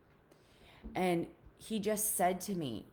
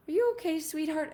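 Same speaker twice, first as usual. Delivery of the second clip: much higher, gentle motherly question; concerned mom; 12 semitones higher, 5 dB louder than usual. Retold or imitated. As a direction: ask like a worried mother. Mom voiced asking gently.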